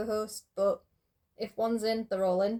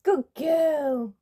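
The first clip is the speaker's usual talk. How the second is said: high, loud delighted praise; excited praise; 4 semitones higher, 8 dB louder than usual. Real, not acted. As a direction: cheer the cat on, thrilled. Cat does trick first time.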